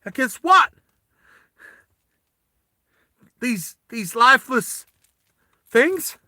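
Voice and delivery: high, loud, broken-up outburst; Vas having a breakdown. Acted. Vas rails over his dead comrade, high distraught shouting. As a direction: demand answers in distress, halting between phrases. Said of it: "Vas has a breakdown".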